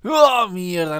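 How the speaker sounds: loud, high cry of frustration; real exasperated yelp; frustrated outburst. Real, not acted. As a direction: blurt it out high and loud as the round is lost. Player loses the game round.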